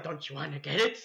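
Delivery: creepy coaxing clown; Pennywise. Retold or imitated. Clown tempts him to reach for quarter, creepy coaxing. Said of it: "I'm Pennywise the Dancing Clown".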